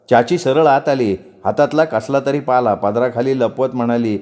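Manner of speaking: plain reading voice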